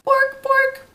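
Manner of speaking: very high yappy puppy bork; little dog barks. Pretend. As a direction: yap like a tiny Pomeranian. Imitating Pomeranian barking.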